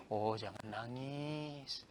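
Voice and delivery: very soft, slow comforting voice. Shown as a demonstration. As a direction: soothe a crying friend gently. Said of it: "Kita bisa katakan". (menghibur dengan lembut dan pelan)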